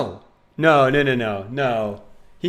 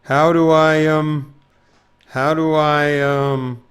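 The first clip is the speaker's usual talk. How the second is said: flustered; 4 semitones higher, 7 dB louder, much slower than usual. Real, not acted. Loud flustered.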